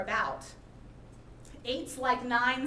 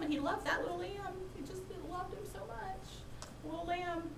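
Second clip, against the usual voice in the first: tender; 6 semitones higher, 9 dB quieter than usual. Acted. Tender lamb in Nathan's parable.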